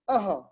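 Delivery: अह with an audible breath of h after the vowel; visarga sound. Shown as a demonstration. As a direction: say अह as a short a plus an echoed ha. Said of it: "ये जो ऐसा pronunciation है". (अह में विसर्ग का ह स्पष्ट)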